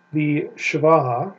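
shava, slow, as SHAH-vah; saying shava. Shown as a demonstration. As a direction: say the term shava slowly and carefully. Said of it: "it's called a shava".

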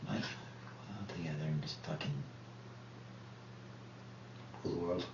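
barely voiced, low near-whisper; quiet, low murmur with long pauses; hushed private plea. Real, not acted. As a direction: say it very softly, for one listener only.

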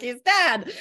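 mock exasperated. Joking about exasperation with mom.

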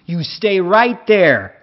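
slow, high, loud American command; angry man ordering the intruder to stay put. A narrator's reading. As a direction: freeze him with a shouted order. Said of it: "rief eine wütende Männerstimme".

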